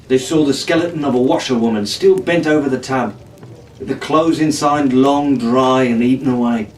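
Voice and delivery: plain reading voice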